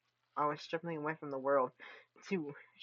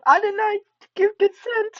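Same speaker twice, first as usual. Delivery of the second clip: much higher, louder, words breaking into laughter; laughing shriek; 18 dB louder than usual. Acted. Streamer shrieking her mock protest through laughter. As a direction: screech it through giggles.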